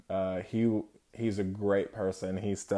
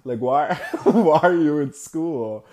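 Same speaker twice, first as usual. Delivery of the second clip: raised, insistent imitation of disbelief; incredulous teacher; 6 semitones higher, 9 dB louder than usual. Teacher questions crying Steven, mock incredulous. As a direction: ask in shock, louder on the repeat, laughing.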